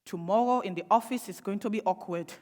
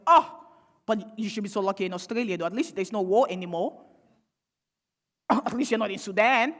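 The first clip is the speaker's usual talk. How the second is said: condescending quip; 5 dB louder than usual. Retold or imitated. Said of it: "somebody went".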